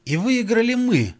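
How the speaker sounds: can't believe it; amazed. Acted. John amazed.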